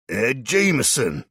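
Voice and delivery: loud, slow yelled name. A narrator's reading. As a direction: bellow through the door. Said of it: "the same voice yells".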